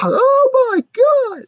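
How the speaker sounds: very high, loud shriek; mock terrified scream. Guard screams at the monster, high and loud. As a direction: shriek like you saw a monster. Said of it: "And it's like".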